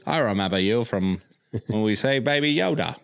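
mock New Zealand accent, playful. Host voicing a Kiwi droid for his friends, New Zealand accent. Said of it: "it's my best New Zealand voice".

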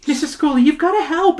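high-pitched, urgent appeal; begging. Acted. Emily asking her teacher for help, begging. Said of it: "Emily begs".

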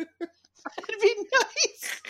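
very slow, high, laughing; slow laughing echo. Pretend. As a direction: stretch it out through a laugh. Echoed slowly while laughing.

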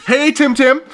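high, loud laughing taunt; giggly mocking nickname; teasing singsong. Teasing Voldemort with a nickname, high laughing.

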